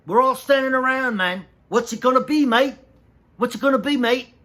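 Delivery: loud, high Australian bark; a mock Australian boss yelling. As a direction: ham up the impatient Aussie director shouting at the crew. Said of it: "so an Australian dick".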